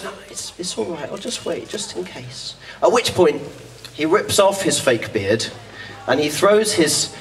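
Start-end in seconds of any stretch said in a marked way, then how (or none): 0.0-2.5 put-on higher, soft and half-whispered girl; meek little voice, softer and higher; timid refusal. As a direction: shyly brush him off, almost under her breath. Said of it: "And she goes".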